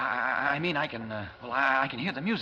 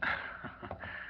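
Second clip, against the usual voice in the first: soft embarrassed huff of laughter; sheepish little laugh; awkward breathy laugh; 9 dB quieter than usual. Acted. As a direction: laugh off a slip. Embarrassed slip, covering laugh.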